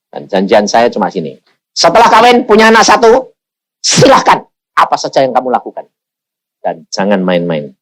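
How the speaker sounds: ordinary talk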